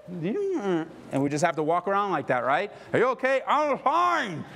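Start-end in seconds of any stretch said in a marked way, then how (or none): 2.9-4.4 very high, loud phony cheer; exaggerated "everything's okay" voice; fake cheerful front. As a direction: put on a big fake smile, pitched way up. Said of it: "we've got our smile".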